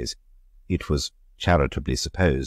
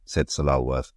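ordinary delivery, nothing marked

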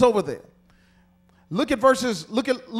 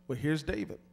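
ordinary talk

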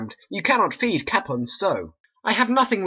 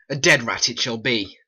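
ordinary talk, nothing marked

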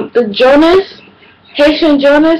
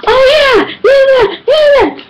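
very high, excited yeah yeah yeah; mock chatty mom. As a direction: squeal it like gossiping mom. Mom chatting two hours later.

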